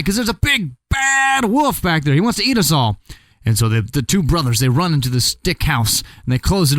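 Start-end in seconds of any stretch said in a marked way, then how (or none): 0.0-3.0 scared pig. Acted. Pig explains wolf chasing.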